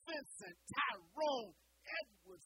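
mock mama yelling the full name, loudest on Tyrone; a hint of a mother summoning her son by full name; mama calling full name. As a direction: call him like an angry mother. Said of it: "when your mama call you by your whole name".

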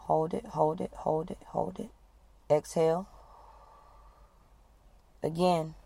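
steady, firm breathing coach leading the group; guided breathing exercise. Acted. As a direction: talk them through each breath and hold.

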